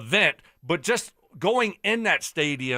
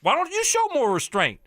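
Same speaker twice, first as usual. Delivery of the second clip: raised, fired-up tirade at the coach; heated rant; 8 semitones higher than usual. Real, not acted. Sports host ranting at a college coach, high and heated. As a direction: lay into him, getting hotter.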